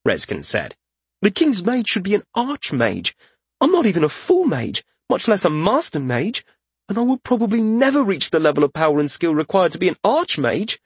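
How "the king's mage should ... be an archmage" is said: high, urgent protest; agitated. Acted. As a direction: protest in rising panic. Panicked protest to the king.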